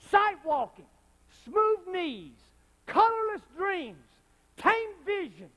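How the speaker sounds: high, loud, slow proclamation; rising pledge. Real, not acted. Preacher proclaiming a martyr's pledge, high and loud. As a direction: build it to a roar.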